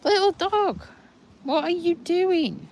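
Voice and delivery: high, slow coo at the little dog; playful high pet voice; high baby talk to the dog. Acted. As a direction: scold the toy dog in a high sing-song. Talking to a misbehaving toy dog.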